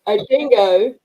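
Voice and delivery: badly faked Australian accent; mock Australian accent; attempted Australian accent. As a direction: another playful stab at Australian. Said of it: "I cannot do an Australian accent".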